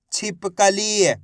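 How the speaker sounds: छिपकली very slow, long final ee; syllables blended slowly. Shown as a demonstration. Teacher reading for students to repeat, छिपकली drawn out. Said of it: "उच्चारण करके इनको पढ़ रहा हूँ".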